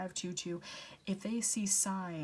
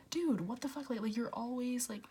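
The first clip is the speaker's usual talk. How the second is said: exasperated; 5 semitones higher than usual. Retold or imitated. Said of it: "They're probably just like".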